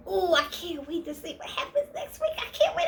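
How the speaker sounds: squealing, rising sharply to a high "I can't wait"; mock excitement. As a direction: act giddy about next week with a laugh. The eager feeling she wanted.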